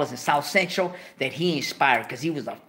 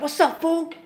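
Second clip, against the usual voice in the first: high-pitched homie greeting; 4 dB louder than usual. Retold or imitated. Steve greets from the car.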